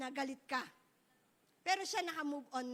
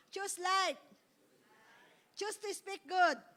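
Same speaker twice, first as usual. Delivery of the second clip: proclaimed motto; 5 dB louder, slower than usual. Shown as a demonstration. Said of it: "O, sige, ulit po".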